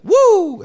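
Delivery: loud, high wrestler's woo; Ric Flair woo. Retold or imitated. Ric Flair leaving the bar, a very high, loud woo. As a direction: let out the famous Flair woo. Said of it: "He's like".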